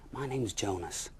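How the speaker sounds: plain reading voice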